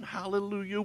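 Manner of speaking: ordinary delivery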